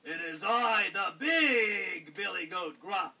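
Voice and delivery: slow, booming announcement; big, loud, drawn-out goat voice. A narrator's reading. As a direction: declare yourself as the huge billy goat. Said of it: "in a loud voice".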